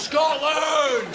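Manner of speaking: very high, strained scream; frenzied patriotic yell; defiant shout. Acted. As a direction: scream it like a rallying cry. Joins the defiant chant.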